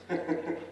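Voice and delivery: short soft chuckle; brief breathy laugh; real laugh. Real, not acted. Speaker chuckling after his mock philosophy lecture, short soft laugh.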